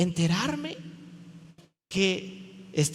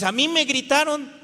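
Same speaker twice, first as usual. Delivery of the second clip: high, loud mock protest; indignant complaint; 7 semitones higher, 5 dB louder than usual. Congregant complaining that someone shouted, indignant. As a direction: protest as the wronged party.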